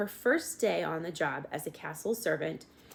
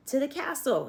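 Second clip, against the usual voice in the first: shouted; 8 semitones higher, 4 dB louder than usual. A narrator's reading. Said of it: "shouted the soldier".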